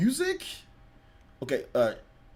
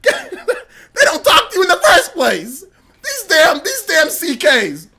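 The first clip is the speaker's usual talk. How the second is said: high, slow, whiny mock voice of an angry fan; mocking Twitter troll; 8 semitones higher, 4 dB louder, slower than usual. Streamer mocking a rude Twitter commenter in a high whiny voice. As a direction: lampoon the offended fan, high and drawn out. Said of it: "That's what somebody says".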